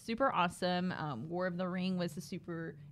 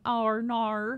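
playful, drawn-out Australian "oh, no"; mock Australian accent; 4 semitones higher, 5 dB louder than usual. [name] giggling to herself over the game, mock Australian accent. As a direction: put on a broad Australian accent. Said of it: "with an Australian accent".

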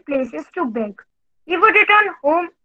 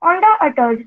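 ordinary delivery, nothing marked

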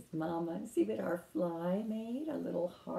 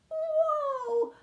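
amazed whoa; 6 dB louder than usual. Acted. Toad amazed at bug count.

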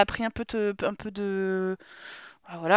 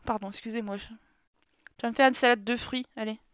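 usual speaking voice

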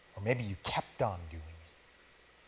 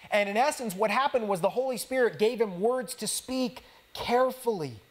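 much higher and louder, building preacher's cry; preaching at full force; 8 semitones higher, 6 dB louder than usual. Acted. Pastor urging the congregation about God using sinners, full-force preaching. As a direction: lift it and hammer the point home.

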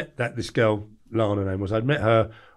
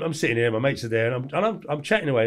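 ordinary talk, nothing marked

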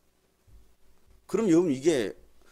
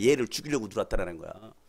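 normal talking voice

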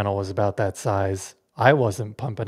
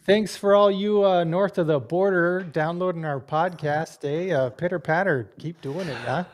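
higher-pitched, hammed-up Canadian accent; poor Canadian accent; 6 semitones higher than usual. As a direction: badly fake a friendly Canadian thank-you. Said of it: "you did that accent so poorly".

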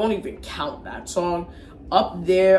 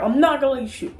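put-on defiant kid voice, pitched up; a kid's higher defiant pitch; imitating Kyle, higher; 7 semitones higher, faster than usual. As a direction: stand firm, voice raised high. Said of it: "He's like".

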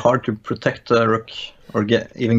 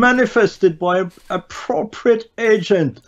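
slow, higher put-on London accent; 9 semitones higher, slower than usual. Shown as a demonstration. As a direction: draw out the line in a mock Cockney London accent. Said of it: "a Norwegian trying to do a London accent".

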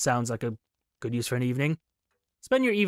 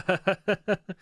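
higher stuttering chuckle after a drawn-out uh; uneasy chuckle; 6 semitones higher than usual. Real, not acted. Player hesitating over a terrible idea, uneasy chuckle. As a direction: chuckle while stalling on a decision.

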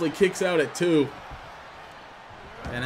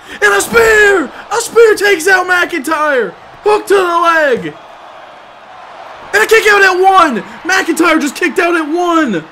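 high, loud explosive call; roaring commentator; excited shout; 9 semitones higher, 4 dB louder than usual. Real, not acted. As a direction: blow up on the spear. Spear and instant kickout.

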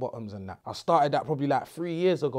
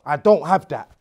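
same words again, much louder and firm; louder repeat; 9 dB louder than usual. Retold or imitated. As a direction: push it out loud at the kid. Dad refusing child in shop.

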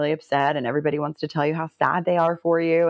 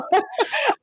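short high giggle; a bright burst of laughter; laughing at herself. Real, not acted. Laugh after crying story.

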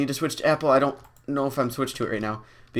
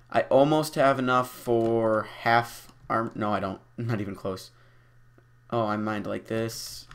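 ordinary talk, nothing marked

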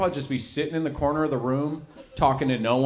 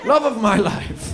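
slow, louder, higher ironic aside; sarcastic; 9 semitones higher, 6 dB louder, slower than usual. Acted. Comic to crowd about his wife, sarcastic. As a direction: drip sarcasm on love.

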